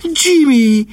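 high, loud call of a name; excited cry. A narrator's reading. Niece spotting the newcomer behind her, crying out. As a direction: cry out his name, voice shooting up. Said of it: "kiáltotta Angela sarkon fordulva".

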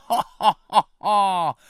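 high, loud jeering ha-ha; mocking laughter. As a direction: bats jeer from the ceiling. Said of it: "hallatszott a barlang mennyezetéről".

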